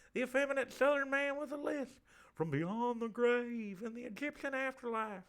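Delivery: high camp Southern voice with a lisp; effeminate lisping Southerner. Acted. Spirit introduces himself to the seance, effeminate Southern lisp.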